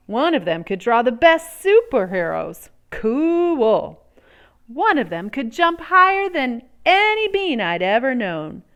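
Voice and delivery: plain reading voice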